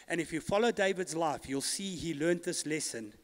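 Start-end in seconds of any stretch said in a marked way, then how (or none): none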